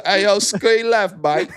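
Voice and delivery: high, slow court shout; called-out play. Pretend. Player calling a screen to a teammate, high drawn-out court shout. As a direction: yell the play across the floor like a point guard. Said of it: "I gotta say".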